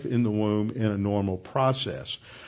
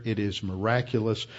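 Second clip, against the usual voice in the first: usual speaking voice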